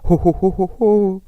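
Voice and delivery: booming ho ho ho like Father Christmas; Santa Claus laugh. Shown as a demonstration. Teacher showing the class a Father Christmas laugh, loud ho ho ho. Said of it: "Comme le O du Père Noël".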